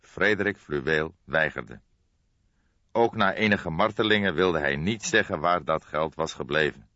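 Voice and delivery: plain reading voice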